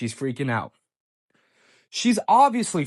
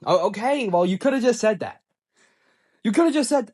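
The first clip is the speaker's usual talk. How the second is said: higher, indignant comeback; exasperated retort; 5 semitones higher, 4 dB louder than usual. Retold or imitated. Boyfriend reacts to her admission, exasperated. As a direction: pitch up in mock acceptance. Retold sarcastic reaction to confession.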